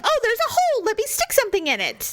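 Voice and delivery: high-pitched imitated excitement; mock eager guy. Mocking guys who poke anywhere, mock eager.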